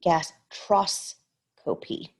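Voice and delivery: gastroscopy said slowly, syllable by syllable; slow careful gastroscopy; slow sounding out. Shown as a demonstration. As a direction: pull gastroscopy apart slowly. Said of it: "Oh, os, tros".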